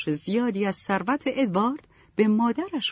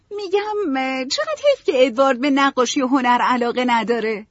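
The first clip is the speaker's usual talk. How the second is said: put-on higher and louder, sighing girl's voice; young Marianne, regretful; 7 semitones higher, 6 dB louder than usual. A narrator's reading. Marianne sighs to Elinor about Edward, dramatically. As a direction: lament that Edward lacks art, dramatically. Marianne teases her sister.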